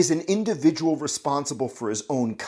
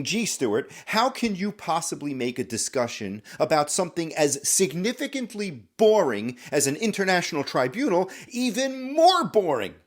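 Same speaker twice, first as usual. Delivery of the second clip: imagined objection, rising high; sceptical listener; 6 semitones higher than usual. Acted. Listener challenging Stuart on a boring topic, mock sceptical. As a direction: draw out the last words in mock disbelief. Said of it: "I know what you're thinking".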